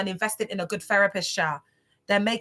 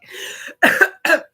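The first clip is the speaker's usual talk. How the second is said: high, breathless giggling fit; laughing fit; 7 semitones higher, 7 dB louder than usual. Real, not acted. Streamer laughing at the camp food story, breathless fit. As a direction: lose it completely. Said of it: "you go into a laughing fit".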